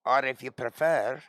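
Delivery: put-on Scottish accent. Shown as a demonstration. Offering viewers another accent, playful Scottish accent. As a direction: slip into a Scottish burr. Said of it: "I could go Scot".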